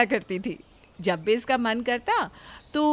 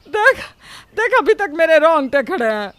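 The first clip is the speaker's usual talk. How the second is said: louder, insistent, still rattled; loud shaken scold; 4 semitones higher, 7 dB louder than usual. Acted. Mother-in-law showing Pinki she is still shaking, loud. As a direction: make her look at what she did to you.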